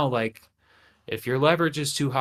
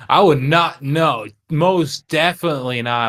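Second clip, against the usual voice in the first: louder, higher, firm refusal; emphatic no; 3 semitones higher, 6 dB louder than usual. Real, not acted. Talking to himself about hiring, emphatic denial. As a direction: insist hard that you would not.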